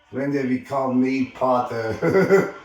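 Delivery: loud comeback; indignant retort. Acted. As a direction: throw the challenge straight back. Harry retorts in a quarrel.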